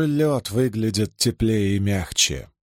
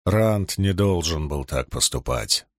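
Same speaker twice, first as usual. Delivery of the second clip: sad boom; 5 semitones lower than usual. A narrator's reading. Loial regrets what Rand did to Perin, sad low rumble. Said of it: "печально прогудел Агир".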